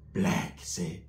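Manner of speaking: very low, near-whispered; imitated cousin murmur; hushed drug mention. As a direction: drop it to a secretive whisper.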